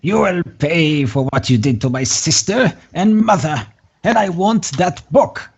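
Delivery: put-on high, menacing old hag. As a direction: threaten them as a vengeful hag. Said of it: "the hag, uh, uh, says".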